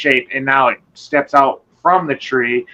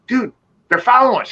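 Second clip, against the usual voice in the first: high, fast urgent warning; urgent alarm; 10 semitones higher, faster than usual. Retold or imitated. Speaker warning cousin that creatures follow, urgent and high. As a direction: hiss it out like they're right behind you.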